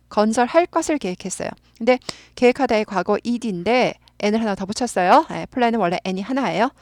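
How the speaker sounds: ordinary talk, nothing marked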